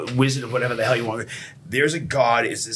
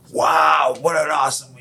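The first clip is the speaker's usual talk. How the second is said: loud, raised wow of admiration; imitated awe; 4 semitones higher, 5 dB louder, slower than usual. Young kid admiring a sports car outside a meeting, loud awe. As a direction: burst out like an impressed kid.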